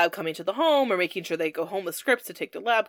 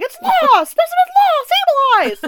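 high, loud frantic wail; hammed-up panic; panicked shout; 10 dB louder than usual. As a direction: yell it like a madhouse worker. Lab staff voiced in chaos.

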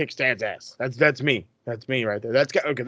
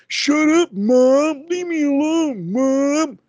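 high whiny bratty voice, much louder; 12 semitones higher, 4 dB louder, much slower than usual. Retold or imitated. Imitating Dominic as a whiny brat yelling at his mom. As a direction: whine it like a spoiled teenager talking back.

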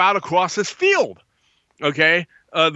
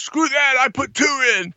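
much higher, brash boast; cocky bravado; 9 semitones higher than usual. Retold or imitated. Frantic buddy bragging about double loading, high and brash. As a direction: toss it off like a show-off. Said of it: "He goes".

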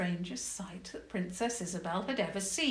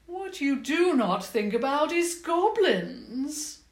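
higher, louder, slow and pointed; goblin queen; 8 semitones higher, 8 dB louder than usual. Acted. Goblin queen reveals her grievance, slow loud voice. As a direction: stress it slowly and darkly.

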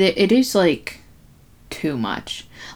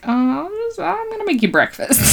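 much higher, hammed-up fumbling voice; mock awkward excuse; 12 semitones higher than usual. [name] making an excuse to the nurse, mock awkward. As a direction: cover up in a high, put-on squeak.

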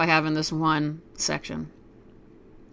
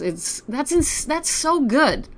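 gushing; 7 semitones higher, slower than usual. Real, not acted. Gushing over the book passage.

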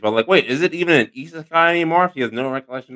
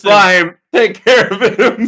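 villain command; 7 semitones higher, 5 dB louder, much slower than usual. Pretend. Commanding the slime.

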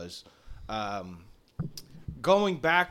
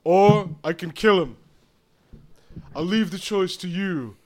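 loud syrupy servant; assistant voice; 3 semitones higher, 8 dB louder than usual. Acted. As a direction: purr the offer louder.

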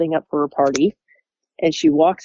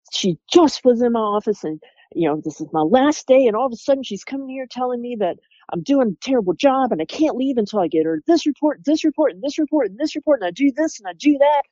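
higher, rushing exasperated rant that slows at the end; fed-up venting; 8 semitones higher than usual. Retold or imitated. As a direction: pile up the reports like you're at the end of your rope. Coworker venting about endless report demands.

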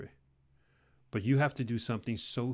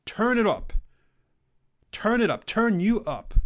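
higher, louder rallying cry; fired-up shout; 8 semitones higher, 5 dB louder than usual. Real, not acted. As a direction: pump the crowd up. Hyping listener to raise energy.

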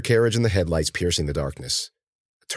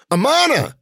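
high, loud call; yelled name; 7 dB louder than usual. A narrator's reading. Cece calling the girl who vanished round a bend, yelling. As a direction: holler it after someone far ahead. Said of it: "and Cece yelled".

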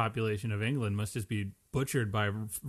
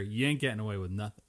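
firm warning; 5 semitones lower, 5 dB quieter than usual. Retold or imitated. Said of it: "they gave him a firm reminder".

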